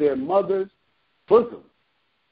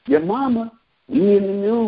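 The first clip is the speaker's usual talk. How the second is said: ordinary talk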